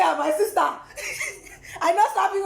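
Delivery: loud, high laughing protest. Real, not acted. Woman laughing to friend about not slapping him. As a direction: laugh it off with a shout.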